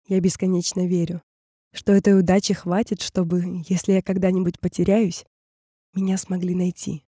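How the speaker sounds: ordinary delivery, nothing marked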